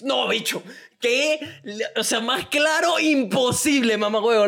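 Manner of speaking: shouting in outraged disbelief; indignant disbelief. Real, not acted. Outraged reaction to listener letter. (incredulidad indignada a gritos)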